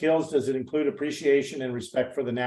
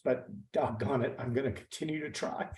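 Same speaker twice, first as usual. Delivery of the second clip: emotional; 9 dB quieter than usual. Real, not acted. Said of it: "I apologize for getting emotional".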